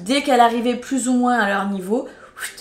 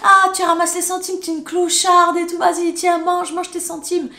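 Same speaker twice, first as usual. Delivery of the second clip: high, loud, mocking taunt; jeering bully; 9 semitones higher, 6 dB louder than usual. Boys taunting the girl picking up coins, loud high jeering. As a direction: sneer at her, pitched up and loud. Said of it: "ils se foutaient de sa gueule".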